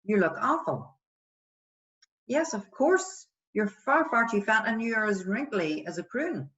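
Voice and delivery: put-on cruel friend; mock agreement. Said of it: "Would you say".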